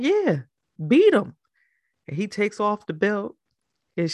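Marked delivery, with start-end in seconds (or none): 0.0-1.3 eager granddad. Retold or imitated. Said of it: "he's like".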